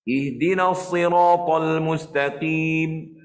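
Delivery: slow chanted recitation, unrounded q in "mustaqim"; qaf yang betul. Shown as a demonstration. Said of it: "Pangkal saja". (bacaan berlagu, "mustaqim" dengan pangkal lidah sahaja)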